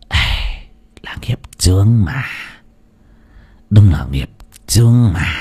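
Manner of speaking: a weary sigh, half whispered. A narrator's reading. The sorcerer sighs over the cursed fate, weary whisper. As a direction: breathe out the words in resignation. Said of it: "đoạn rồi thở dài".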